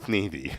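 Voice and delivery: "Sneezy" lisped as thneethy; soft lisp on "Sneezy". Shown as a demonstration. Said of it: "say it with a little bit of a lisp".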